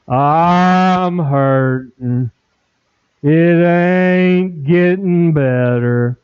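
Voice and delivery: slow, droopy donkey moan; mopey cartoon donkey, very slow; gloomy Eeyore. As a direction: drag every word out like Eeyore. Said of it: "We become Eeyore".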